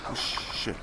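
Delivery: breathy whisper under the breath. Real, not acted. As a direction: mutter it almost silently.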